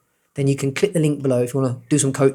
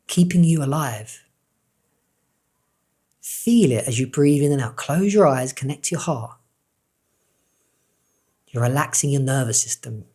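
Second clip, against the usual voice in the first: guided meditation. Acted. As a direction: ease the listener into calm breathing. Leads breathing meditation.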